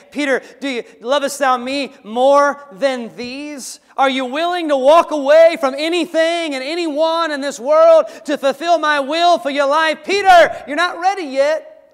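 loud, high preacher's voicing of Jesus; booming call to walk away from the world; Jesus pleading with Peter. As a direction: plead with Peter at full volume, stretching the question. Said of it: "But he was saying".

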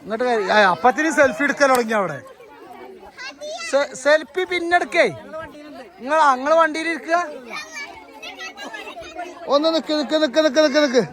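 usual speaking voice

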